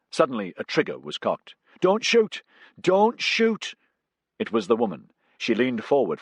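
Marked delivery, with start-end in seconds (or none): 1.8-2.4 high, loud desperate cry; panicked high yell; frantic plea. A narrator's reading. Woman pleading at gunpoint. 2.8-3.7 high, loud desperate cry repeated; panicked high yell; frantic plea. A narrator's reading. Woman pleading at gunpoint.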